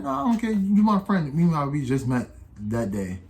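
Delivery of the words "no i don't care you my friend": much higher, crying-toned imitation; mock whiny friend. Friend refusing to leave him, high whiny mock voice. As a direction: whine it like a hammed-up friend. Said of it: "So, like".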